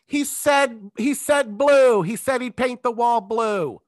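high, loud, drawn-out heckle; audience shouting out. Retold or imitated. Audience member feeding a contestant the answer, mock shouting. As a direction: imitate a spectator calling out the answer. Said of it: "if they're shouting out".

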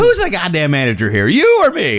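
rising into a high, loud mock yell; half-hammed coach's outburst, climbing high; mock yell as Jimmy. Podcast host quoting Jimmy to his cohost, hammed-up yelling. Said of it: "he does that whole scene".

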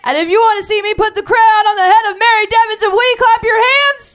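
high, loud hype call; shouting. Real, not acted. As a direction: pump up the crowd. Host calling crowd to vote.

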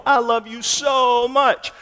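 high, slow gush with a long "so"; mock-effusive, stretched "so much"; stress on SO MUCH. Preacher voicing the misreading of God's love, high and drawn out on "so". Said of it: "not about mainly how much God loved the world".

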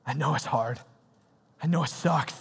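ordinary delivery

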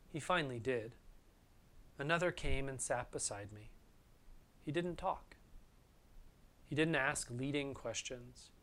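soft, low, measured reading with long pauses; hushed, unhurried and grave; solemn quote reading. Acted. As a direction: read a grief memoir quietly, phrase by phrase. Reads long quote on grief.

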